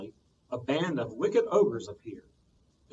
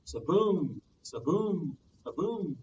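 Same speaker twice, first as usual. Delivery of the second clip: sound of waves; 3 semitones higher, slower than usual. A narrator's reading. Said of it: "went the sea".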